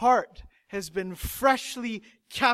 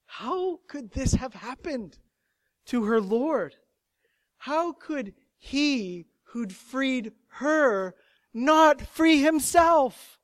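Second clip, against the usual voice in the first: raised, slow disbelief; grieving, incredulous appeal; anguished questioning; 6 semitones higher, 4 dB louder, slower than usual. Acted. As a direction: plead slowly, pressing each word higher. Voicing Mary's anguished questions.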